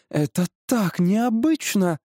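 high, slow marvelling; enraptured wife. Acted. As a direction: savour the wonder, drawing it out. Wife's eyes shine at talking tree. (восторженно, высоко и протяжно)

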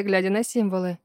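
ordinary delivery, nothing marked

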